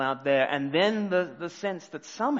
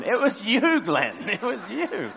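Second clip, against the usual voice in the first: laughing; 8 semitones higher, 6 dB louder, slower than usual. Real, not acted. Laughing at himself.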